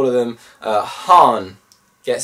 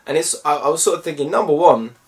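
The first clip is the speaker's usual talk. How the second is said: ordinary talk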